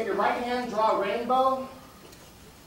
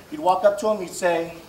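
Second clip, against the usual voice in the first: normal talking voice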